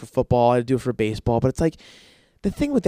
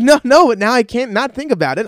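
higher, louder anxious blurt; mock panic; 7 semitones higher, 6 dB louder than usual. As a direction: freak out a little. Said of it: "I'm like".

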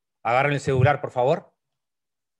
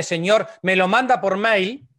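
usual speaking voice

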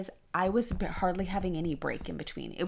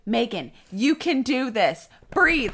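louder, slow, firm encouragement; coaching nurse; 5 semitones higher, 8 dB louder, slower than usual. Retold or imitated. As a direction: coach her through it. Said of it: "And she's like".

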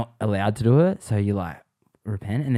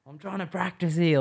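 high, teasing protest; cheeky high-voiced grumble; mock complaint; 9 semitones higher than usual. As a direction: fake annoyance, taking the piss. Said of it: "just taking the piss".

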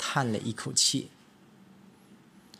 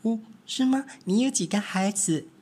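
high, lively little mouse voices; little mice; 9 semitones higher than usual. Acted. Three little mice chattering to each other, high and eager.

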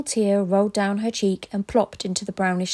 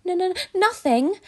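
stammering; 9 semitones higher than usual. A narrator's reading. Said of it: "stammered Cam".

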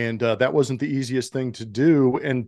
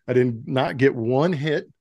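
ordinary delivery

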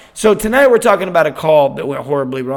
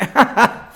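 re-enacted startled laugh, higher pitched; imitated nervous laugh; 7 semitones higher, 4 dB louder than usual. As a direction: laugh it off uneasily, acting it out. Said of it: "And I went".

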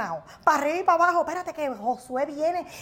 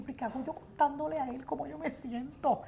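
hushed thought; 10 dB quieter than usual. Retold or imitated. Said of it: "tú, y yo".

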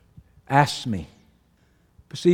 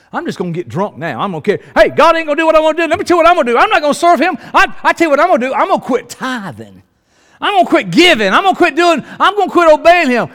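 higher, louder, fast defiant rant; mocking a believer who vows to punish God; mock tantrum; 7 semitones higher, 6 dB louder, faster than usual. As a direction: sulk out loud like a spoiled rebel.

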